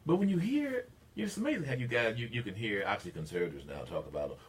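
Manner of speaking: ordinary talk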